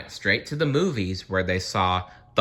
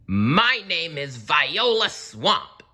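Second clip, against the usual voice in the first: slow, higher menacing teacher voice; scratchy witch voice; 9 semitones higher, 3 dB louder, slower than usual. A narrator's reading. Strict substitute Viola Swamp facing the class, a lightly voiced scratchy witch. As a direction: introduce yourself like a storybook witch. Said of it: "said the lady in a scratchy voice".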